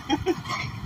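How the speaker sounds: a quick airy laugh breaking through; breathy laugh. Real, not acted. Preacher laughing at his own jab at the crowd, breathy laugh. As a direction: laugh under the breath at the joke. Laughs after mocking proud people.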